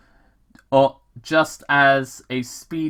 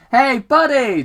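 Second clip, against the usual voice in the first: much higher and louder hail; loud friendly cop call; 13 semitones higher, 8 dB louder than usual. Acted. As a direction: call across the road to a driver. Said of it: "Tom called out".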